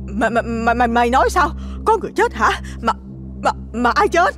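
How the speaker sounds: high, stuttering terrified mistress; stammering in shock. A narrator's reading. Mistress stammering at the servant's news of a death. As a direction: stammer out the question in panic. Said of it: "miệng lắp bắp".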